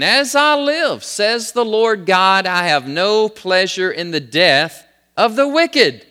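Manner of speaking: high, slow, forceful proclamation; emphatic scripture preaching. Acted. Preacher voicing God's plea to the house of Israel, emphatic.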